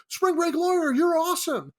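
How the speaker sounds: put-on high, pumped-up frat boy fan. Frat boy spotting the Spring Break Lawyer, high excited gushing. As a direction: gush like a starstruck frat bro. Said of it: "frat boys and people recognize him, are like, 'Dude".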